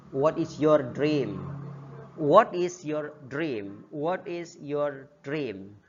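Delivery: What is your dream said slowly for repeating; slow drill. Shown as a demonstration. Teacher modelling the question slowly for students. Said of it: "repeated slow drill".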